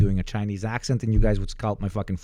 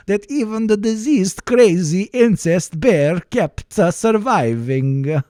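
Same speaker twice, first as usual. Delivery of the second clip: slow, high, loud Swedish singsong; hammed-up Swedish with a bouncing lilt; Swedish accent; 5 semitones higher, 4 dB louder, slower than usual. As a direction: declaim slowly in an exaggerated Swedish accent. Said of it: "Your Swedish accent is better".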